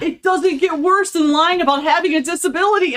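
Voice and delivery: high, loud panicked wail; horrified panic. Retold or imitated. Student realising the lie is very bad, high loud panicked outburst. Retelling her horror at her own lie.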